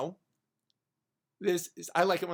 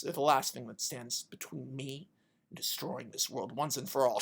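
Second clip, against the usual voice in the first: low, soft villain's offer, sinking as it goes; a touch of quiet menace; lightly voiced villain; 4 semitones lower, 5 dB quieter than usual. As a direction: tempt them with hushed menace. Villain god tempts Diana.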